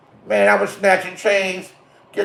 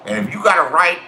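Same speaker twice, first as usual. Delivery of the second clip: ordinary delivery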